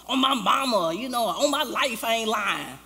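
high, loud mock oath; insistent swearing. People swearing to the preacher while lying, mock insistent. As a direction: imitate someone vehemently vouching for themselves.

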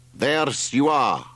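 put-on slow, high, drawn-out accented announcement; Spiro. A narrator's reading. Driver pointing out the villa, slow high accented voice. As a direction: present it slowly in a foreign accent.

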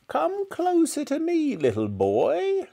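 very high, drawn-out beckoning; witchy grandmother. A narrator's reading. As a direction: lure the boy in like a witch. Witchlike grandmother luring George.

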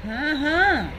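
ordinary delivery

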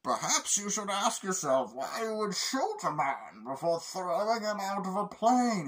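calm menacing villain line; Bane. Retold or imitated. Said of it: "Bane just says".

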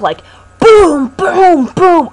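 booming knock sounds, loud; loud knocks imitated. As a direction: slam each boom like a knock. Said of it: "imitating loud knocks".

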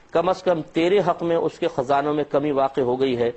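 usual speaking voice

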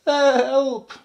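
high, loud story-time yelp; spluttered cry for help. A narrator's reading. Baby elephant splashed by a hippo, high alarmed cry. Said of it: "spluttered the baby elephant".

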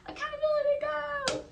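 fast, high mock instructor call building to a shrill cry; drill instructor. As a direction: call and response like a drill sergeant. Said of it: "he's gonna be like".

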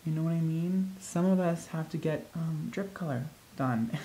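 soft, hushed mock sympathy; soft mock apology. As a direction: murmur it to the mannequin. Talking softly to the mannequin.

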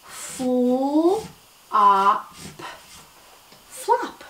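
segment then blend "flop" brightly; stretched sounds. Shown as a demonstration. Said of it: "write it with me".

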